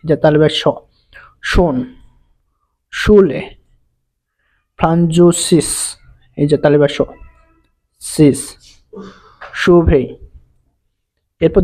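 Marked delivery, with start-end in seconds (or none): 1.5-5.7 German sch sounded out word by word. Shown as a demonstration. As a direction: model sch with a clear "sh". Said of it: "উচ্চারণ হয় তালিবা স এর মতন".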